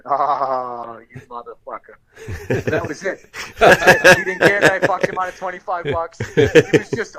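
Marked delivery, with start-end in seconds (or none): 0.0-1.9 lightly voiced boss, half amused, half beaten; grudging respect. Boss meets the kid who outplayed him, low grudging respect. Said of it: "the way he said it, there was a tone in his voice that he respected".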